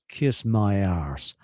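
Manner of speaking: slow, low Texan brush-off; gruff Texan. Retold or imitated. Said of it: "this bloke, bloody great Texan".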